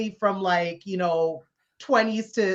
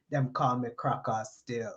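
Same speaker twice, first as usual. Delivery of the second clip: put-on low, soft Caribbean accent, leaning back; a laid-back Caribbean man giving his nickname in a lower, softer voice; lightly imitated Caribbean accent, low and soft; 8 semitones lower, 8 dB quieter than usual. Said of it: "in his Caribbean accent".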